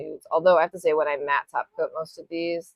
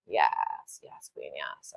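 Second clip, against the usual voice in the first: sassy approval; 5 dB quieter, much slower than usual. Real, not acted. Self-approving color choice.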